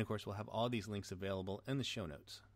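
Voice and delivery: ordinary talk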